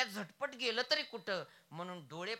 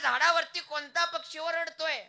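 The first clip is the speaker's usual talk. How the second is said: put-on old father calling; 8 semitones higher, 9 dB louder than usual. Old father calls to his son.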